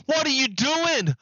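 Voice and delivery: high, loud incredulous outburst; shocked outcry. Retold or imitated. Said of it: "And everyone's like".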